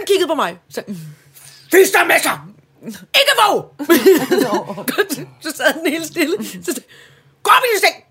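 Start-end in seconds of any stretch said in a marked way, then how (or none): 1.7-3.7 high, loud retold scolding; scolding the dog. Yelling at dog at night. 7.4-8.0 high, loud order to the dog; stern command. Retold or imitated.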